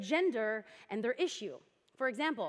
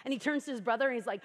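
normal talking voice

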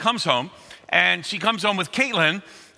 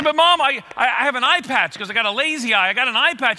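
put-on whiny kid protest; 7 semitones higher than usual. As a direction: plead like a scared little boy.

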